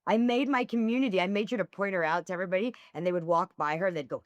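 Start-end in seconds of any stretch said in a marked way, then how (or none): none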